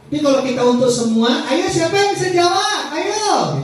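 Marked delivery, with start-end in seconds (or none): 1.5-3.6 much higher eager classroom call; rally the whole class to answer; bright cheerful teacher. Shown as a demonstration. Teacher calling the whole class to answer, high bright voice.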